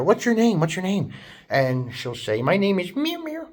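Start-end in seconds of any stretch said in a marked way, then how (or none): 0.0-1.1 put-on higher, eager child voice; kids asking. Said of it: "they'll ask her". 2.4-3.5 much higher, mewing voice; cat noise. Retold or imitated. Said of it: "She does, like, a cat noise".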